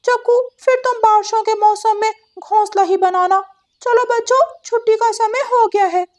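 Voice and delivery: a chirpy parrot quizzing the class; parrot teacher voice. Acted.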